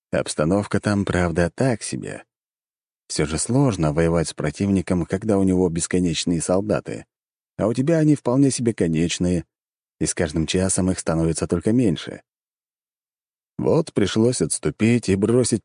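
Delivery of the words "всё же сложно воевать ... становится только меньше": ordinary delivery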